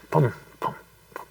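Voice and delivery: soft spoken thumps, spaced like a pulse; short hushed booms in a steady beat; imitated heartbeat. Reminding listeners of the heartbeat behind the words, imitated heartbeat.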